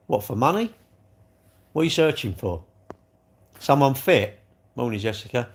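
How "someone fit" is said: ordinary talk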